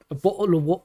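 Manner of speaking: mock-British with dropped t's; playful glottal-stopped British; British accent. Shown as a demonstration. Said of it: "Do a British accent".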